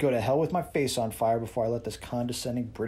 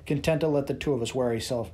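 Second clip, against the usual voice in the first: ordinary delivery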